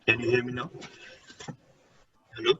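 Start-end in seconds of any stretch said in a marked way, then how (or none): none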